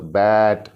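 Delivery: बैट with a long vowel; slow, stretched बैट. Shown as a demonstration. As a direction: draw out the middle of बैट. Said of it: "देखो इसको stretch किया".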